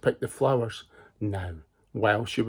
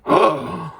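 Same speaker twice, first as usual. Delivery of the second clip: dramatic gasp; 4 semitones higher, 4 dB louder than usual. Acted. Wolf lies in wait.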